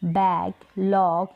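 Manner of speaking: बैग, लॉग said slowly with a hard g at the end; slow hard g demo. Shown as a demonstration. Teacher to students, modelling the hard g slowly. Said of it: "ऐसे तो नहीं बोल रहे हैं".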